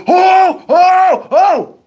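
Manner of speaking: high, loud imitated "oh" hoots; three rising-energy crowd hollers; crowd noise. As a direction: whoop like a hyped crowd. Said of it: "they making noise".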